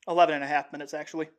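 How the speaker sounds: usual speaking voice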